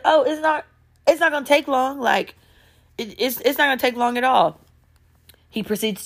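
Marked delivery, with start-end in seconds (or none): 0.0-4.6 higher, casually insistent voice; imitated breezy man. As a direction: imitate him brushing off her excuse, pitched up. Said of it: "he's like".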